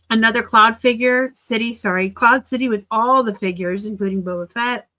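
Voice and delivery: usual speaking voice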